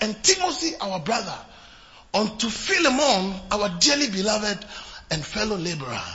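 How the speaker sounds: measured Bible reading, slowing on the church; scripture reading. Acted. Pastor reading Philemon to the congregation, solemn. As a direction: read the verse with solemn weight.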